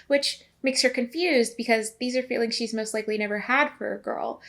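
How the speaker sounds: ordinary talk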